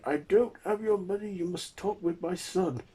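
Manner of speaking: put-on very high, pleading old man voice; high-pitched helpless old man; manhandled old man. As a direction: protest in a high voice while being dragged around. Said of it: "He then grabs the old man".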